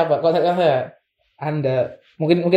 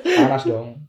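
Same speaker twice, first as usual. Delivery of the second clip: laughing; 11 semitones higher, much slower than usual. Real, not acted. Joking about hot Monas shoot.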